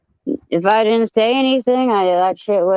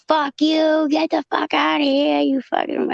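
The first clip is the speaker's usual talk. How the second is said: mock screaming; 9 semitones higher than usual. Woman getting in the caller's face at the house, mock screaming. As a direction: mimic someone screaming abuse, pitched way up. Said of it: "screaming at me, like".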